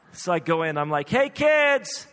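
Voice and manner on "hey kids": loud, high, drawn-out dad announcement; mock-cheerful bad news at full volume; hammed-up announcing. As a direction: call it out to the kids, stretching the words. Dad breaks bad news to kids.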